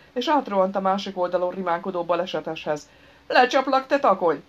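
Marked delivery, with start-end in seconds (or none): 3.3-4.4 loud, high threatening shout; angry shout. A narrator's reading. Furious driver with raised tool threatening him, shouting. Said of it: "kiáltotta, feje fölé lendítve a szerszámát".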